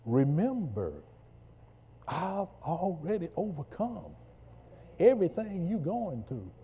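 building from firm to a roaring, high climax; full-force gospel preaching; fiery preaching. Acted. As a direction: preach it with rising fire until the shout.

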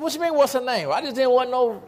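loud, very high protest; mock indignant protest. Preacher answering nosy people about his dyed beard, mock indignation.

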